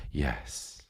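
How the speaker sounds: put-on tiny soft kid reply; small child's yes. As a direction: answer like a shy toddler.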